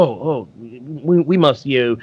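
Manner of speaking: ordinary talk, nothing marked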